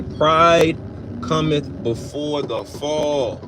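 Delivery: PRIDE set apart, then "cometh before the fall" drawn out slowly; slow, weighty repeat. Real, not acted. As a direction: quote the scripture slowly, pausing after "Pride" to let it sink in. Repeats scripture for it to sink in.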